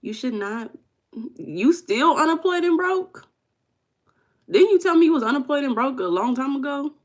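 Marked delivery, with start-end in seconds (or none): 1.4-3.3 high-pitched astonished question; incredulous repeat. Real, not acted. Streamer to viewer still out of work, incredulous. 4.5-6.9 high, loud disbelief; incredulous questioning. Real, not acted. Streamer to viewer still unemployed and broke, loudly incredulous.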